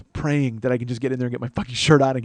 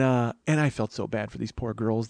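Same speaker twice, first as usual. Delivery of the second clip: usual speaking voice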